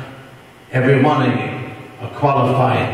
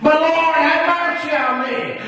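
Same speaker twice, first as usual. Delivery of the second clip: desperate cry; 12 semitones higher than usual. Acted. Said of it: "It didn't sound too humble".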